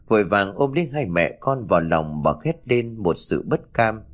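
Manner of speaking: ordinary delivery, nothing marked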